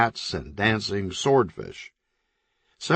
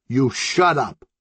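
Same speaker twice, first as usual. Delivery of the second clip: yelling back; 4 semitones higher, slower than usual. Acted. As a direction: yell back through the wall. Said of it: "a floral shirt yelled back".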